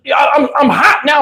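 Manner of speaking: ordinary talk, nothing marked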